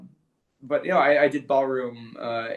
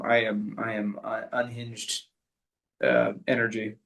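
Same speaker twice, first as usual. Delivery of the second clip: usual speaking voice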